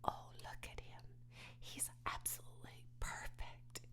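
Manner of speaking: soft, awed whisper; girl marveling at her newborn pig. A narrator's reading. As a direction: breathe it in wonder. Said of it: "she whispered".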